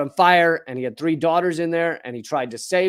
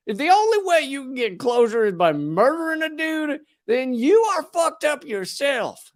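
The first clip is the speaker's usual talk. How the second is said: Southern accent slipping in; 9 semitones higher than usual. Real, not acted. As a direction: let the Southern accent take over as you get worked up. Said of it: "if sometimes I slip more into my Southern accent".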